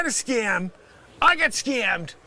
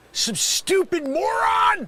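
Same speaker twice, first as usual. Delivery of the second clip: insult; 9 semitones higher, slower than usual. Acted. Scammer insults caller.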